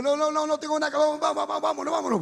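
negativa atropellada, voz aguda; huida apurada, actuada. Retold or imitated. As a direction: apura a todos para escapar, agudo. Acting panicked refusal and getaway. (pánico actuado, insistente)

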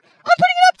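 high, loud declaration; furious and determined; real angry defiance. Real, not acted. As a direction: shout it stubbornly. Defiant after losing an hour's recording.

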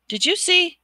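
sharp high alarmed question. Acted. Harry missing his wand, suddenly alarmed. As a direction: jolt up in alarm.

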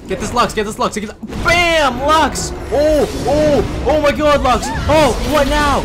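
high, loud hype building to screams; triumphant yelling. Real, not acted. Player going after Lux again, screaming with gloating excitement. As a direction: shout it as the hits land.

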